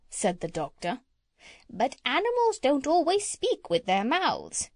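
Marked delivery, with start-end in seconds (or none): 1.7-4.7 high voice. Acted. Parrot lecturing the doctor in a higher voice. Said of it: "said the parrot in a high voice".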